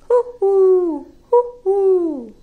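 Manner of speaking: high, loud owl hoot; playful high hoo-hoo. Pretend. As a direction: call out like a snowy owl. Owl call at goodbye.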